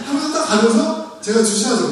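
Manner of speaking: ordinary talk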